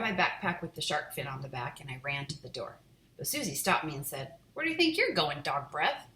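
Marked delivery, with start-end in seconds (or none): 4.5-6.1 mocking. Sister mocking brother.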